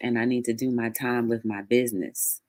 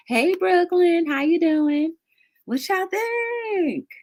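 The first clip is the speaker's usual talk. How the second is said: excited greeting; slower than usual. Real, not acted. Greeting a viewer by name.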